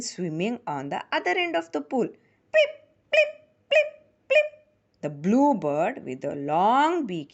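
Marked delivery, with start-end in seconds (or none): 2.5-4.5 very high popping plips; water plip. A narrator's reading. Narrator makes kingfishers' splashes, very high plips. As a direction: drip the sound like water drops.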